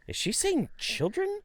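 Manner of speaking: very high, slow puzzled voice; drawn-out, squeaky bewilderment; baffled kid. Retold or imitated. Students whispering among themselves, high and baffled. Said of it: "They're like".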